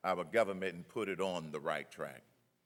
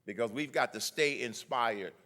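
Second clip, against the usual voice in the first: normal talking voice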